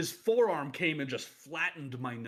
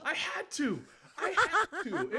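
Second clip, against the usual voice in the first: a real laugh runs through the words, higher; giggling out the excuse; 12 semitones higher, slower than usual. Real, not acted. Defending the prank to the co-host, laughing.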